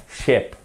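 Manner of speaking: "ship" with a brief, lax i; short back vowel. Shown as a demonstration. Teacher repeats for learners, "ship" with a short i. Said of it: "Vous allez vers l'arrière".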